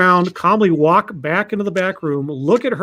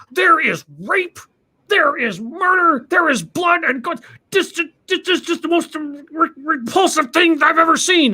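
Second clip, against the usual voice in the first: hammed-up furious customer; outraged rant; 11 semitones higher than usual. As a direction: rail at the clerk in disgust. Angry customer ranting at clerk.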